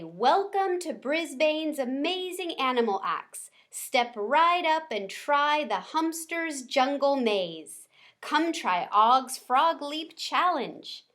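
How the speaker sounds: dramatic barker voice. A narrator's reading. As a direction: call out like a showman. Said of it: "loved Missus Brisbane's dramatic voice".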